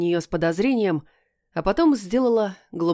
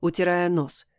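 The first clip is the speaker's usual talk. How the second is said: ordinary delivery, nothing marked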